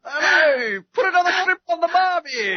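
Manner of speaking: high, loud mock Aussie call; hammed-up Australian accent. Annoying American teasing an Australian guest, loud mock Aussie. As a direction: holler like a cliche Australian. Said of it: "I'm an annoying American. Sorry".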